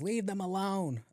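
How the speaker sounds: put-on high, slow old lady voice. Old lady at the window warning him, high and slow. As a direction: slowly warn him off in an old lady's voice. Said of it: "This old lady just goes".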